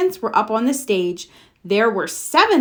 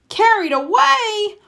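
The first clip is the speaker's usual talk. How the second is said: indignant; 13 semitones higher, 4 dB louder than usual. Acted. Papa protests indignantly.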